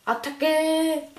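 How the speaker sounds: high slow French cry; French accent. Acted. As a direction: shout it like a Frenchman. Mock French battle cry.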